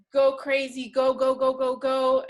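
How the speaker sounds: ordinary delivery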